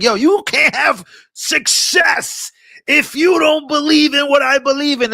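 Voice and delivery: high, slow mock shout of an angry fan; mock zealot yelling. Mocking a pushy supporter who shouts at him, high and slow. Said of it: "It's like".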